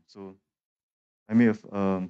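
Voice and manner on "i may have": ordinary delivery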